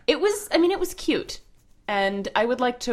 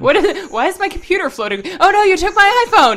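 high, loud, laughing panic; mock panic; 12 semitones higher, 11 dB louder than usual. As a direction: squeal in fake alarm. Playing panicked Apple staff.

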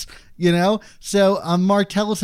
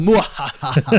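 hammed-up villain cackle; mock evil laugh. Host joking about his dating plan, mock evil laugh.

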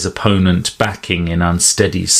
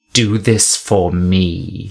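slow, chant-like intoning; intoned; slower than usual. A narrator's reading. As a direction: intone it like a spell. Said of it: "she intoned".

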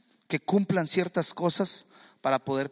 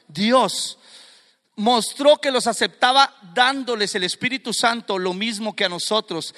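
loud, raised declamation of scripture; forceful preaching; 5 semitones higher, 7 dB louder than usual. Acted. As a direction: proclaim the verses to the congregation at full force. Preaches verses at full force.

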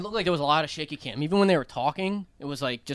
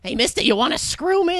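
high-pitched cartoon moll impression; Harley Quinn; 10 semitones higher than usual. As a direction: put on a high comic-book villainess voice. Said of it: "Harley Quinn".

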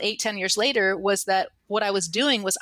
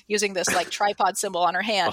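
usual speaking voice

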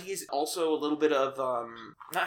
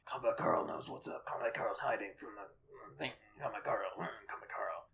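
hushed gruff soldier whisper; secretive action figure voice; Combat Carl voice; 6 semitones lower, 11 dB quieter than usual. Retold or imitated. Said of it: "the Combat Carl's like".

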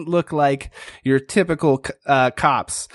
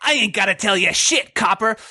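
higher, defiant little thug; tough frog suspect; 6 semitones higher than usual. Acted. Frog suspect defying the cop, higher and mocking. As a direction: sneer it at the cop. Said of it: "looks up and says".

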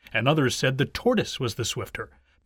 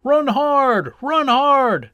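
high, loud crowd cheer; shouting; 9 semitones higher, 6 dB louder, much slower than usual. A narrator's reading. Some onlookers urge the tortoise on, shouting. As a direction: yell it twice to spur on the runner. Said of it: "shouted some".

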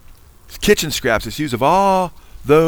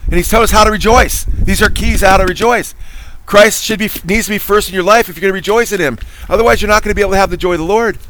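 fervent preaching; 5 semitones higher than usual. Acted. Preaching at full force.

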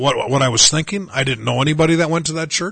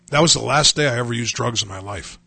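ordinary talk, nothing marked

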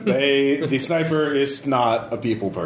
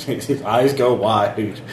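ordinary delivery

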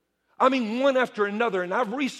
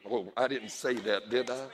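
mock aside; 10 semitones lower, 8 dB quieter than usual. Mock slip caught mid-sermon.

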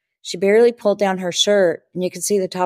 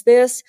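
"this" punched higher and louder; stress on this; 5 semitones higher, 5 dB louder than usual. Retold or imitated. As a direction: hit the word "this" hard. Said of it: "please be quiet and just witness".

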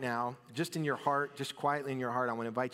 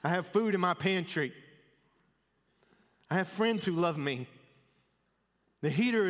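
buoyant and louder, counting blessings; a gladly raised, thankful list; grateful excitement; 5 semitones higher, 4 dB louder than usual. Pretend. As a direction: lift each line with thanks. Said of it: "Or we could say".

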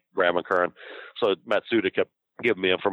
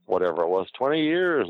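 high, slowed pleading voice; lightly put-on aggrieved promoter; mock Mike pleading; 9 semitones higher than usual. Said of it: "And he told the guy".

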